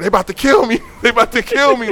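high, loud terrified yell, retold with a laugh; panicked screaming. Running down the street from attackers, screaming in panic. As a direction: scream for your life like they're right behind you. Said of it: "I was screaming".

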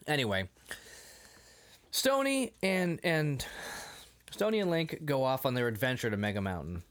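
normal talking voice

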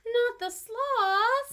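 high, drawn-out loud protest; pleading mock-upset cry; mock dismay. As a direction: wail it in playful horror, stretched long. Mock dismay over sloths being prey.

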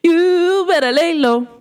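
high, slow diva impression; high drawn-out celebrity impression. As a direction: stretch it out high, imitating the singer. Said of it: "In my [name] voice".

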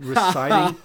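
mock reciting. Mocking stiff recitation.

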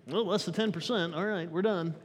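lightly voiced dry accountant; a hint of a fussy number-cruncher, counting it off; Hebrew accountant voice. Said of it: "that was my Hebrew accountant voice".

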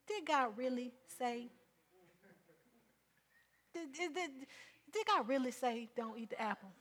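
soft, sly tempting question; mild hint of the tempter; sly serpent voice. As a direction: wheedle like the serpent. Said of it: "just like he did Eve".

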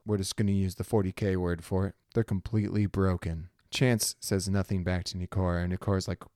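ordinary delivery

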